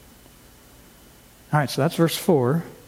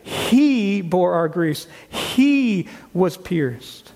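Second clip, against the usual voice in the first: "He" and "he" stressed, very slow; emphatic he; much slower than usual. Shown as a demonstration. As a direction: read slowly, leaning on each "he". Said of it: "So we should read it as".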